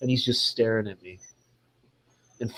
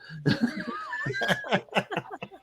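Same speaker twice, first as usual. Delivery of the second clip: high, breathy burst of laughter; real laughter at the surprise; big laugh; 6 dB quieter than usual. Real, not acted. Laughing at hearing the name George Lucas.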